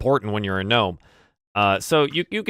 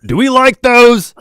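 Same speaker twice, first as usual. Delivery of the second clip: high, slow, loud shout; dwarf guard yelling to his boss inside; 11 semitones higher, 11 dB louder, slower than usual. Acted. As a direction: bellow the question back over your shoulder. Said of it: "he says".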